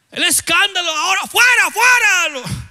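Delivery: high, loud, hammed-up command; imitating the shout he held back, high and loud; mock exorcism shout. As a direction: bellow it to show what he could not do. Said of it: "no me podía poner a gritar".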